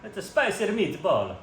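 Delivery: hammed-up Italian accent, high, slow and loud; slow, high cartoon Italian accent; mock Italian accent. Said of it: "maybe I got it a little better".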